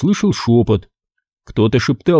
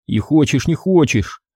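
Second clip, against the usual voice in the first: plain reading voice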